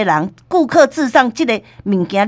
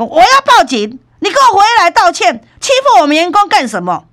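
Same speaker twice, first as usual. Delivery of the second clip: louder, higher imitated yelling; angry shout; 8 semitones higher, 5 dB louder than usual. Manager yelling at the customer to come back, angry shout. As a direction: shout him down, defending your staff. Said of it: "店长就讲".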